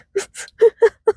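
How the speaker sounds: squeaky high burst of laughter. Real, not acted. Telling the flashcard story, a high laugh. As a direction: break into a high giggle.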